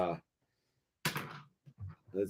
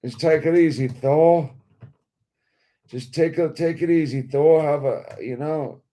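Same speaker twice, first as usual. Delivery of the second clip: put-on light English accent, pitched higher; easygoing English accent, raised pitch; 6 semitones higher, 4 dB louder than usual. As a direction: play the toy as a chummy English bloke calming Thor down. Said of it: "Doesn't he have, like, an English accent?".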